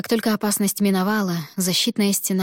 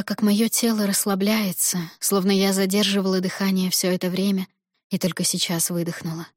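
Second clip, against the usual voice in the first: plain reading voice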